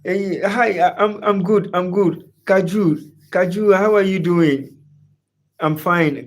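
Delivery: ordinary talk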